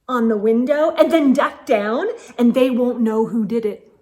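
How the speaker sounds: usual speaking voice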